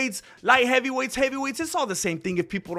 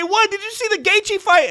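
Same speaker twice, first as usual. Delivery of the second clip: incredulous critic; 12 semitones higher, 4 dB louder than usual. Retold or imitated. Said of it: "They say".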